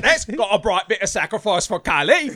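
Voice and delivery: hammed-up British cult priest, laughing; British accent. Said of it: "played by a British guy".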